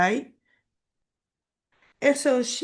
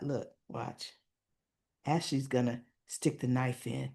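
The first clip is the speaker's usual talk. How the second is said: low, soft inner thought; hushed aside; 8 semitones lower, 10 dB quieter than usual. Retold or imitated. Narrator thinking Ashley will hurt Derek, hushed aside. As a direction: drop the voice as if confiding.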